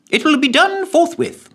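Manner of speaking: very high, eager official; secretary. Acted. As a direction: promise briskly.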